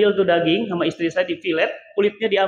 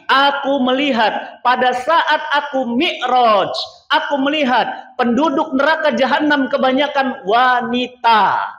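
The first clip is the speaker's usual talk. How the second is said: solemn quote; 4 semitones higher, 4 dB louder than usual. Acted. Preacher quotes the Prophet to the women, loud and grave. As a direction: declaim the hadith gravely.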